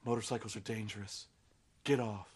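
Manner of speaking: low, soft spectral voice; ghostly warning. Retold or imitated. Ghost of Edward warning Bella, low and soft. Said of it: "the ghost of Edward is like".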